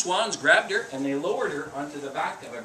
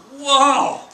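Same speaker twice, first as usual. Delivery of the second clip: big amazed whoa, much louder and higher; 9 semitones higher, 12 dB louder than usual. Acted. Storyteller acting amazement for the kids, loud whoa.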